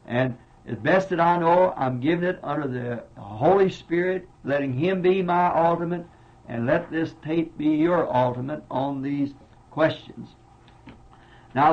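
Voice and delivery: ordinary delivery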